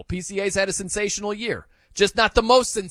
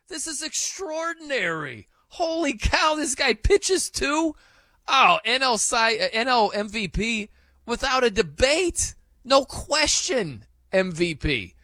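high, slow, gushing mock amazement; high, drawn-out astonished fan voice; imagined amazement; 7 semitones higher than usual. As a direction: gush like a stunned fan. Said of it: "They'd be like".

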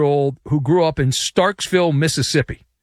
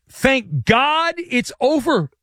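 high, slow, drawn-out sigh of relief; relieved quote; 10 semitones higher, much slower than usual. Retold or imitated. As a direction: stretch out "Thank God it's over" slowly and higher like a huge relief.

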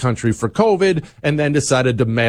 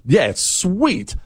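ordinary talk

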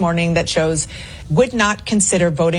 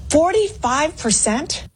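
astonished; 10 semitones higher, slower than usual. Real, not acted. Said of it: "she's astonished".

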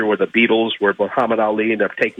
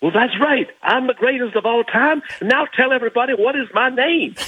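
high, boastful Ali impression; Muhammad Ali; 14 semitones higher than usual. Storyteller voicing Ali boasting to an old man, high and proud. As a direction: crow it like the champ, much higher. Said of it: "So Ali goes".

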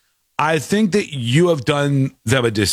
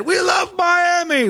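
mock drunk fan shout; 12 semitones higher, slower than usual. Quoting drunk Scottish fan.

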